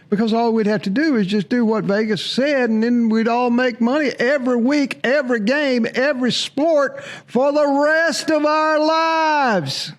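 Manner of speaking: sarcastic, hammed-up enthusiasm rising as it goes; mock excitement. Host mocking the logic of trusting Vegas, building mock excitement.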